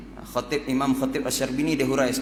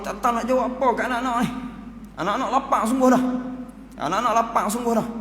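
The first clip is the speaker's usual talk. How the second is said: distressed mother; 8 semitones higher, faster than usual. Acted. Ibu sedih memikirkan anak lapar.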